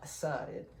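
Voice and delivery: cool, casual "Suh, dude."; easygoing street greeting to a stranger; catchphrase greeting. Retold or imitated. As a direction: toss it off like a laid-back bro greeting. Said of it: "and I did the".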